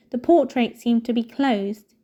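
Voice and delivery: plain reading voice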